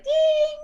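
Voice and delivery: high, loud drawn-out "ding"; imitated ringing. Woman mimicking the ringing that woke her at night, high imitated ring. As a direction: mimic a piercing ring in the ears. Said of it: "the biggest ringing in my ears".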